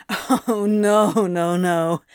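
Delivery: hollow, humourless half-laugh on the words; drawn-out, bitter denial; mirthless laugh. A narrator's reading. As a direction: laugh it off without any mirth. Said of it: "Crowley laughed mirthlessly".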